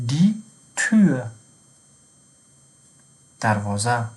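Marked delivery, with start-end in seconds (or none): 0.1-1.3 دی تور slowly, with Ü in تور; higher, drawn-out دی تور as German "die Tür"; slow German model. Shown as a demonstration. Teacher models German umlaut example.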